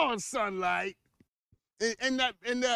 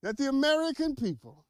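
higher, louder preaching take; preacher voice; 6 semitones higher, 4 dB louder than usual. Shown as a demonstration. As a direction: lift it like a sermon opener. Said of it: "gotta get my preacher voice for this".

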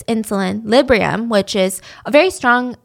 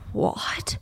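shocked whisper; 11 dB quieter than usual. Retold or imitated. Ex reacts in shock.

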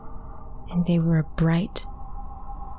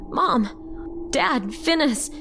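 higher-pitched calling out, held back in volume; acted shout; 6 semitones higher, much slower than usual. A narrator's reading. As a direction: call for the family, pitch climbing. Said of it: "I shouted".

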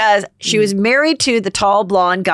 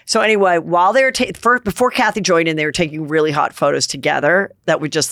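ordinary delivery

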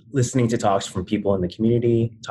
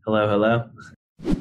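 playful hello; much slower than usual. Real, not acted. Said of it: "could you say hello".

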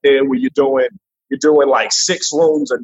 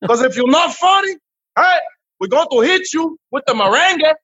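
high-pitched mock Dominican accent, pleading Papa; lightly exaggerated Dominican Spanish-flavoured English; 9 semitones higher than usual. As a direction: ham up a higher Dominican club owner voice. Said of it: "for Dominicans".

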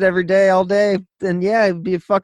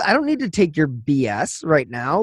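usual speaking voice

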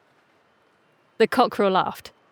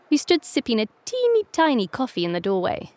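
plain reading voice

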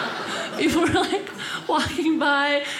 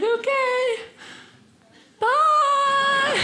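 very high singsong farewell; chirpy goodbye; much slower than usual. Retold or imitated. Driver leaves her new friends as meter expires, chirpy goodbye. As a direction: sing out a drawn-out little bye.

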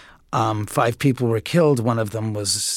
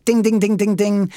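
mock alarm bell; 7 semitones higher than usual.

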